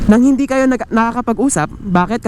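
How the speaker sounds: usual speaking voice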